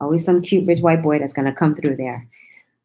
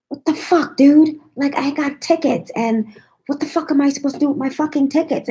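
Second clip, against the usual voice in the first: mock angry rant; 9 semitones higher than usual. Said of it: "he was just screaming like".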